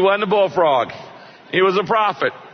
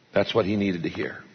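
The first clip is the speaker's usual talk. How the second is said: ordinary delivery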